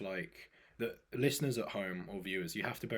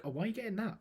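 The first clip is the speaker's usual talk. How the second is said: high quizzical mimic; mocking family member; 11 semitones higher, much faster than usual. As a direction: imitate a nosy relative. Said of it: "they'll be like".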